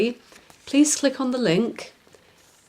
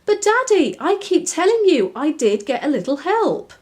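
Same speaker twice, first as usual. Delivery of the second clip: higher, lightly voiced little girl; a child insisting to her father; little girl Daisy; 8 semitones higher, 5 dB louder than usual. Daisy insisting to Daddy she had help, in a higher little-girl voice.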